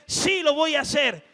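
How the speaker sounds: loud, high stubborn shout; defiant believer. Acted. Believer defying God, loud stubborn insistence.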